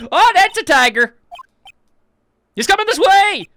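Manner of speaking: high, loud alarm cry; panicked shout. Acted. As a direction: yell in terror at a charging animal. A tiger is charging at them.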